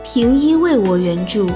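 ordinary delivery

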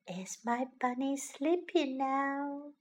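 put-on hushed question to a sleepy child; soft whisper. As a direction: ask it softly so nobody wakes. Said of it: "whispered Missus Rabbit".

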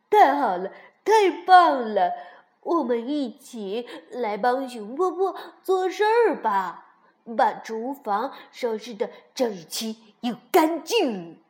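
loud, happy cheer. A narrator's reading. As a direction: cheer with delight, loud and bright. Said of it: "跳跳也高兴地说". (高兴地大声欢呼)

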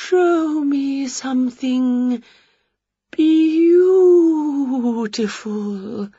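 very slow, drawn-out quavering spook; dreamy ghost. Acted. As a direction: stretch beautiful out.

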